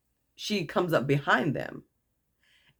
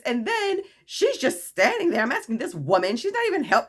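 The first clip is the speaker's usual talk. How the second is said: high-pitched mock complaint; retold yelling; 8 semitones higher, 3 dB louder than usual. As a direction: imitate an indignant woman ranting, voice pitched up. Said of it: "literally yelling at me now".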